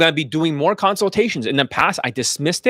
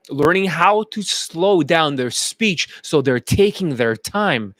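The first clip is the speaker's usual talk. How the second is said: slow, unhurried demonstration pace; slowed-down speech; slower than usual. Shown as a demonstration. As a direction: take your time on every word. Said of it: "kinda like this".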